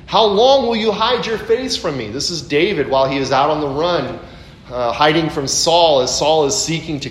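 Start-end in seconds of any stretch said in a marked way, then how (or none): 0.0-2.1 high, raised cry to God; psalmist's raised lament. Acted. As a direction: plead like the hunted David. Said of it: "where David says".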